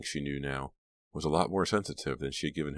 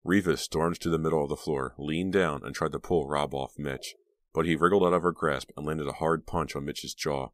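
ordinary delivery

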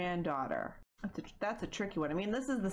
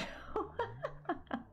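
breathy laugh; 14 semitones higher, 5 dB quieter than usual. Real, not acted. Laughing at own joke.

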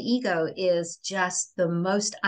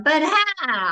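whiny but how; 7 semitones higher, 4 dB louder than usual. Retold or imitated. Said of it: "you would have gone".

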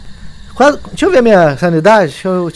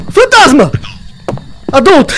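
high frightened yelling; panicked shout; 7 semitones higher than usual. Real, not acted. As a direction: cry out in panic. Ghost appears, panicked shouting.

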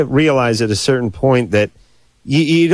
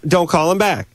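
normal talking voice

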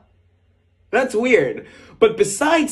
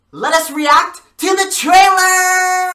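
high, drawn-out announcer shout; loud rising hype, last word stretched; hyped shout; 10 semitones higher, 8 dB louder, slower than usual. Real, not acted. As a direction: yell it like launching the main event. Kicks off the trailer reaction.

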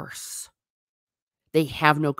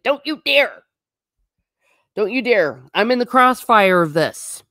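higher, punchy acted outrage; raised-pitch mock scolding; mock indignant warning; 6 semitones higher, 5 dB louder than usual. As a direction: warn someone off, playing up the indignation. Acted angry reaction to being triggered.

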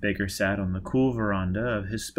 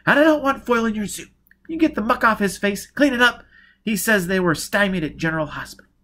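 high, loud, insistent demands; heated, raised-voice command; snapping orders; 11 semitones higher, 6 dB louder than usual. Acted. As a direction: order him about, impatient and sharp. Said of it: "Giz snapped".

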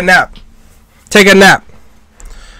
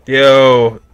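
ordinary talk

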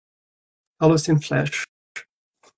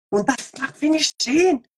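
mock stern German order, raised high; aggressive German; 9 semitones higher than usual. Streamer parodying German, mock stern command. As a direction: play a harsh German commander. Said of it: "sounds like sehr aggressiv".

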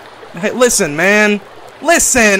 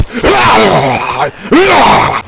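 strained shouting laugh; 6 semitones higher, 6 dB louder than usual. Real, not acted. Meat stolen.

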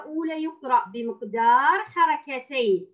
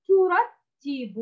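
slow ترتب, pitched higher; slow model reading; 6 semitones higher, much slower than usual. Shown as a demonstration. Teacher reads the third word, slow and high. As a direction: read ترتب slowly and high. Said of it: "كلمة".

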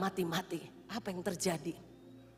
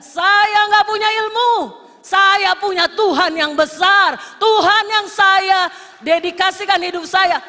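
high, loud, slow declaration; defiant shout; 8 semitones higher, 15 dB louder than usual. Retold or imitated. Preacher shouting down the sorcerers who sent curses. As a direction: shout your faith back at them.

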